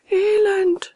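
high, loud one-word lament; piteous high cry; wailing echo. A narrator's reading. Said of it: "echote die Katze".

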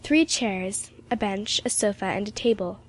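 plain reading voice

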